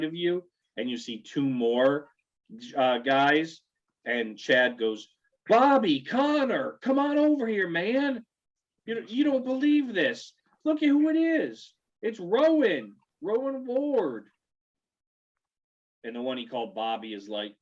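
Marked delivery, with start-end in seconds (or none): 5.5-14.3 much higher bro voice, calling his buddies over; high bro calling friends. Acted. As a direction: call them over like an excited frat guy.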